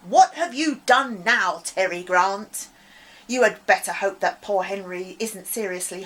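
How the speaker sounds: loud scolding teacher; angry shout. Acted. Strict teacher shouting at a pupil, angry. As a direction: bark at the boy, furious. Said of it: "As she shouted".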